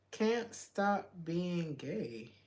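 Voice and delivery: usual speaking voice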